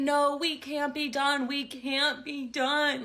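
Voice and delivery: hammed-up slow, high-pitched bawling; mock bawling. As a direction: drag it out like an ex pleading through tears. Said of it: "Like, bawling his eyes out".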